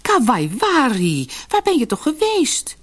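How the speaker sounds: relieved mother. A narrator's reading. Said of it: "vroeg de vrouw".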